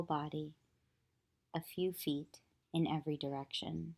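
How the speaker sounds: calm, even meditation voice with long pauses; unhurried yoga nidra guidance, leaving long silences; guided meditation. Acted. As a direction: guide them gently into stillness, phrase by phrase.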